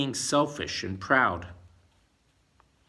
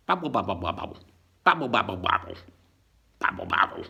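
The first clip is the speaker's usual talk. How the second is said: mock babbling; 5 semitones lower, 4 dB louder, faster than usual. Mimics confused babbling.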